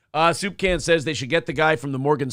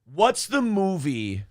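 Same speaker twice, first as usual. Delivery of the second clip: usual speaking voice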